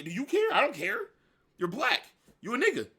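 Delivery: loud, high heated rant. Acted. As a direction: drive it home, raising the voice.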